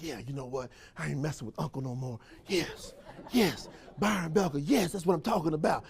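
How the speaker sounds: low, soft muttered agreement; mock quiet resolve; quiet conviction. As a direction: say it under your breath.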